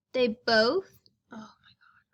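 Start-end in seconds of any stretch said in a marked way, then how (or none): 1.3-2.1 real whispered oh my God, caught off guard; startled whisper. Real, not acted. Teacher startled mid-lesson, whispering under her breath. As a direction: drop to a shocked breath of a whisper.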